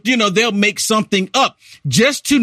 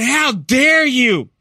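higher, slow huffy indignation; mock outrage; 6 semitones higher, much slower than usual. Mocking angry online critics, in huffy mock outrage. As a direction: play the offended liberal. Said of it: "They were so angry with me".

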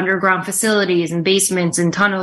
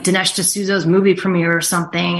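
usual speaking voice

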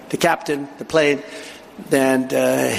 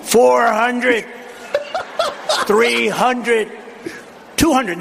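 tense countdown; 7 semitones higher, much slower than usual. Retold or imitated. Retold tense countdown near landing.

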